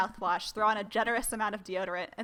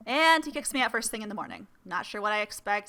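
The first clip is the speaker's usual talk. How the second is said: usual speaking voice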